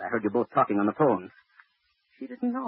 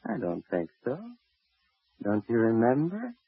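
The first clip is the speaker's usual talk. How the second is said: ordinary talk, nothing marked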